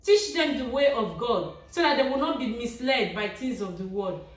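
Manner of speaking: usual speaking voice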